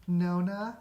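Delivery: put-on high, breathy falsetto girl voice; bad girl voice. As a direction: squeak the name like a terrible girl. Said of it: "the worst girl voice I can muster".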